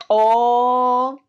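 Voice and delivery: a loud, open O that lets the pencil drop; open vowel O. Shown as a demonstration. Teacher showing students the pencil falling on O. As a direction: throw the O out with the jaw open. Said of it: "El lapicero sale disparado".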